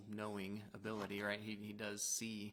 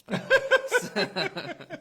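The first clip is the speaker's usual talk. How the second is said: laughing; 18 dB louder, much slower than usual. Real, not acted. Laughing through the words.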